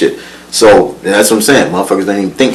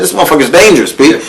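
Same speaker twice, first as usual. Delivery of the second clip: ordinary talk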